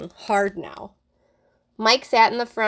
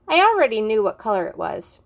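ordinary delivery, nothing marked

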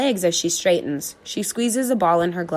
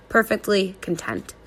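ordinary delivery, nothing marked

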